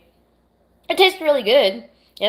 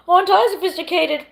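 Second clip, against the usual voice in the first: mock posh; 11 semitones higher, 5 dB louder than usual. Joking about drinking tea.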